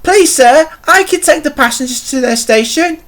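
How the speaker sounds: high, polite pleading voice; eager Stepney. A narrator's reading. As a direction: offer help keenly, pitched well up. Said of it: "he suggested".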